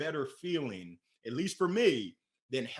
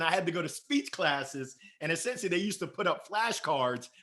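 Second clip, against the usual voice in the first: normal talking voice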